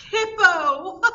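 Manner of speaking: high, loud squeal of laughter around Hippo; laughing out the name. A narrator's reading. Rhinoceros squealing his name through laughter. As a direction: shriek the name while laughing.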